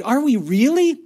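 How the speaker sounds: "really" leaned on, high and loud; stress on really. A narrator's reading. Daughter doubting the promised room, excited stress on "really". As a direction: cry it out with weight on "really". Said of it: "understood the stress on that last word".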